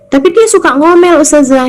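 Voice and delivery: high, whiny plea to the teacher; complaining wife. Retold or imitated. Wife complaining to the ustazah about a nagging husband, high whiny plea. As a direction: play the wife griping about her husband. Listener's complaint voiced by preacher.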